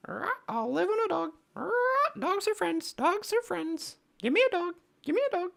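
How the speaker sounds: very high squawking parrot; bird voice. Acted. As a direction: screech it out like a parrot. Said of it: "have you never heard my bird voice before?".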